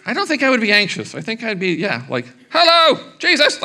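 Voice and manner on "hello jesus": put-on high, loud call for a lost kid; exasperated parent shout; frantic calling out. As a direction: yell his name across the crowd like a panicked parent.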